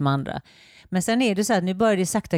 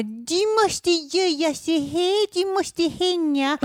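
higher, very slow, commanding mock voice; bossy official; 11 semitones higher, much slower than usual. Mocking a Stockholm official giving orders, slow and bossy. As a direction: lay down the rules like a pompous inspector. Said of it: "de kommer bara komma".

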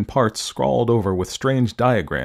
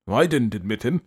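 put-on grumbling old man. A narrator's reading. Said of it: "grumbled the old man".

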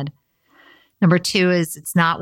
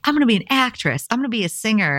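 high, quick self-quote, dreamily ambitious; eager mock declaration; 6 semitones higher, faster than usual. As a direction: announce big dreams in a higher, faster voice. Retelling her eager childhood career plans.

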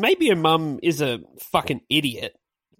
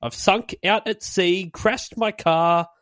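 reciting lyrics; 5 semitones higher than usual. Shown as a demonstration. Quoting the song's chorus to the others, recited higher. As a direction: read the chorus out with a sing-song lilt. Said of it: "the chorus is".

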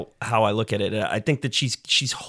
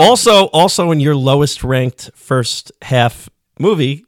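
loud teasing jab; 3 semitones higher, 8 dB louder, slower than usual. Real, not acted. Teasing a cohost.

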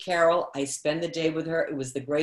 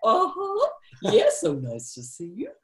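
Carol Burnett impression; 14 semitones higher than usual. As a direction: play Carol Burnett fielding audience questions. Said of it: "I played Carol Burnett".

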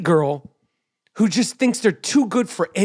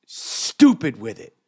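STUPID stretched slow and low; slow, low, savoring STUPID; drawn-out emphasis; 8 semitones lower, slower than usual. Real, not acted. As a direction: lean hard and long on "stupid". Emphatic hype about Irving's play.

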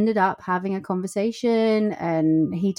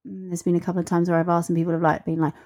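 normal talking voice

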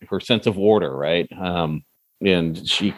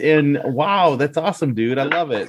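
high, bright praise; excited; 6 semitones higher than usual. Real, not acted. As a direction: gush with delight over the news. Praising friend's progress excitedly.

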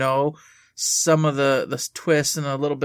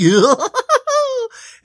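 very high clown shriek laugh; shrill cackling clown giggle; 8 dB louder than usual. Acted. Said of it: "Never clown voice".

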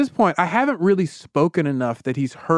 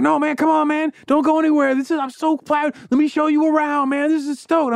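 excited fan; 13 semitones higher than usual. Retold or imitated. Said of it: "And the guy's like".

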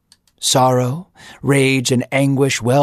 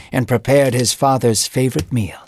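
plain reading voice

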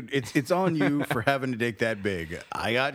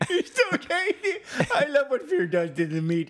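gleeful crocodile; 5 semitones higher than usual. Retold or imitated. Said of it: "that reptile might have been like".

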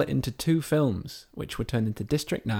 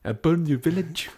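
higher-pitched mock South African threat; South African accent; 5 semitones higher than usual. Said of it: "the South African accent".

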